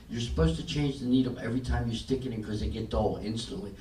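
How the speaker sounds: normal talking voice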